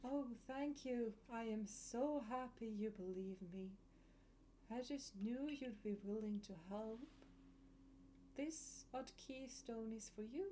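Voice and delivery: hushed and haunting, like a ghost; eerie voice. Acted. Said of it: "Quite the eerie voice".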